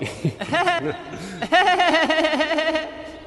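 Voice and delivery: high forced chuckle; nervous laugh at a beer joke; awkward laugh. Acted. Laughs at his own joke.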